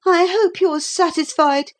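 put-on high-pitched, resentful boy; bitter childish reproach. A narrator's reading. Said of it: "he said bitterly".

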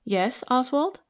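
preoccupied, questioning lilt; absent, spoken aside. A narrator's reading. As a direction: answer aside while intent on a phone call. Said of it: "spoken aside from the phone".

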